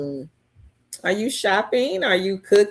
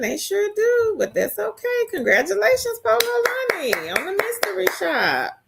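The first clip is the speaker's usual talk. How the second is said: much higher, sing-song cheer; high congratulations; 13 semitones higher than usual. Real, not acted. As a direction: beam it up high for her. Congratulating a viewer.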